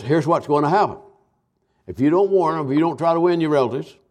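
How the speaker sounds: ordinary delivery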